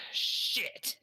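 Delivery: high-pitched mock-horrified curse; shocked reaction. Reacting to realizing it is Auschwitz.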